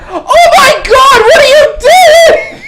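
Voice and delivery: very high, slow, shrieking horror; horrified scream. Acted. Passerby screams at the vampire ripping off an arm, horrified shriek. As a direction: scream it like a bystander who just saw a vampire feeding. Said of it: "she's like".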